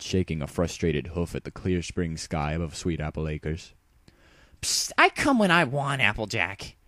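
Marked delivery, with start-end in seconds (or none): 4.6-6.8 high, cocky, dismissive retort; Rainbow shooting back. A narrator's reading. Rainbow Dash sassing Applejack as she lands, cocky. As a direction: brush her off with swagger. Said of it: "she shot back".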